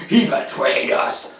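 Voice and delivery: breathy, drawn-out hammed-up accusation; mock-dramatic gasp of betrayal; mock betrayed outrage. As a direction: act the scandalised fan in a slow, voiceless rasp. Mock outraged fans, repeated.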